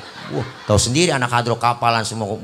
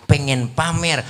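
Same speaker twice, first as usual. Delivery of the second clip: ordinary talk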